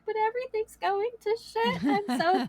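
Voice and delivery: high-pitched mock wail; imitated tearful meltdown; retold blubbering. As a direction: whine it as if blubbering, voice way up. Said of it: "I'm a blubbering idiot at this point".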